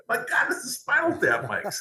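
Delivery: high, thrilled cry of surprise; excited discovery. Retold or imitated. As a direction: exclaim it like you just struck gold. Finding the famous mic.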